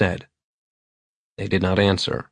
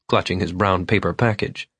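ordinary delivery

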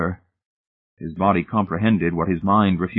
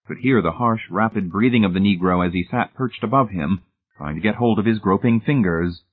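plain reading voice

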